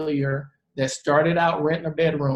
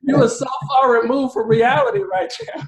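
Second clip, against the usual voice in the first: incredulous; 6 semitones higher, 3 dB louder than usual. Retold or imitated. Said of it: "I'm like".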